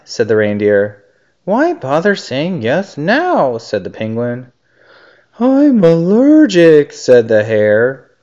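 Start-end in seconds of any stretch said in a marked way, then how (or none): 1.4-3.6 penguin brush-off. A narrator's reading. 5.4-6.9 high, loud, drawn-out hare voice; hare making excuses. A narrator's reading. Hare makes excuse in story.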